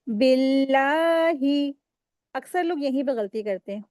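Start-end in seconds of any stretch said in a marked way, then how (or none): 0.1-1.8 बिल्लाही slow, ही short and unstretched; thin लाम in बिल्लाही, ही not drawn; correct tajweed. Shown as a demonstration. Tajweed teacher models the word for students, slow and careful. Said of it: "जल्दी पढ़ेंगे".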